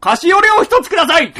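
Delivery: huge, high-pitched shouted bar order; overly loud order. Acted. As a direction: yell for a drink far too loudly. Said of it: "そんなにでかい声じゃないでしょ".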